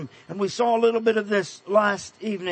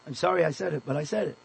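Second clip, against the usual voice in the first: ordinary talk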